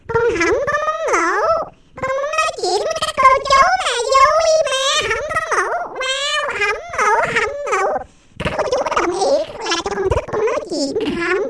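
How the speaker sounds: put-on excited child voice. Child Út refusing bed.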